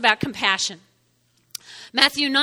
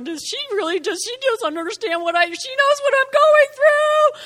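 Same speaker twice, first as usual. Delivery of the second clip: mock tearful; 10 semitones higher than usual. Online listener crying over the message, mock tearful. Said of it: "listening to this message crying".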